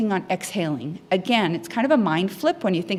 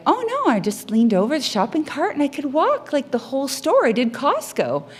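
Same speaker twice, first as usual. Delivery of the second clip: mimicking a thrilled client, pitched higher; excited client; 7 semitones higher than usual. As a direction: play the proud client who just discovered the cart trick, voice lifted high. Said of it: "And they're like".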